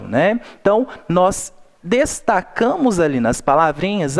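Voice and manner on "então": usual speaking voice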